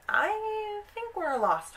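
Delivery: high, drawn-out whine; worried little girl. Retold or imitated. Frightened girl, lost at dusk.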